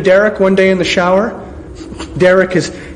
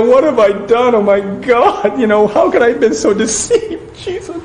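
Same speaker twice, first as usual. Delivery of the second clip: mock weeping; 5 semitones higher than usual. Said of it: "the weeping".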